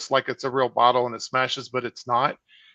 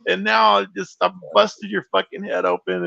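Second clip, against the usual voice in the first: higher, distraught retelling of his own apology; retold upset crying; 6 semitones higher than usual. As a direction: replay the guilt of hurting a friend, pitched up. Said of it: "start crying".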